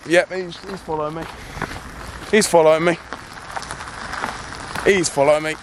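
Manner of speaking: realising he's being followed, getting scared; alarmed. Acted. Vlogger spotting the masked driver tailing him, alarmed.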